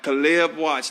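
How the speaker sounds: Caleb said kuh-LIB, stressed on the second syllable; slow, careful "kuh-LIB" for Caleb; correct name pronunciation. Shown as a demonstration. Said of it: "proper pronunciation of your name".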